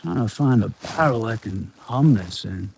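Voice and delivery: ordinary delivery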